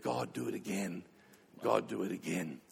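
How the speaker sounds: put-on soft, low prayer in the old chapel; hushed prayer. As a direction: pray it under your breath. Group prayer in Wesley's chapel.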